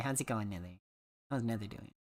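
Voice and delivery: ordinary delivery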